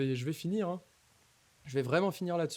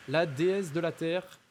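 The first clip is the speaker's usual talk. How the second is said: dramatic boss announcement; 4 semitones higher, 3 dB louder, much slower than usual. Acted.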